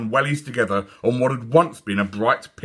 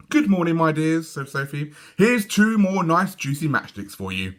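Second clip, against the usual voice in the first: a touch higher, tender hello to bugs; lightly fond little girl; fond greeting; 7 semitones higher than usual. A narrator's reading. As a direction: greet them like dear pets. Sophie greets woodlice.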